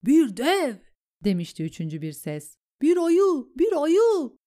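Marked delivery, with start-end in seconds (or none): 0.0-0.8 high, loud shout; alarmed cry. A narrator's reading. Panicked cry in battle. 2.8-4.4 high, loud repeated shout; insistent cry. A narrator's reading. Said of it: "diye ısrar etmişti".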